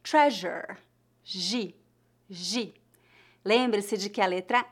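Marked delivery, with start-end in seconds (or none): 0.0-0.8 "treasure" said in English, zh sound in the middle; English pronunciation. Shown as a demonstration. Teacher citing an English word for the G sound, English pronunciation. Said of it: "como a letra S na palavra". 1.2-2.7 "Gi" with the zh of treasure; soft G. Shown as a demonstration. Teacher showing learners G before I, soft G. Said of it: "a letra G antes da vogal I".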